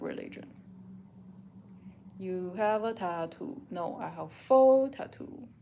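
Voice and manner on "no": ordinary talk, nothing marked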